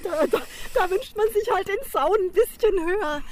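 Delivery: high, loud quoting of a punchline; amüsiertes Zitat. Retold or imitated. Zitiert amüsiert die Pointe des Gastes. (amüsiert zitierte Pointe, hoch und laut)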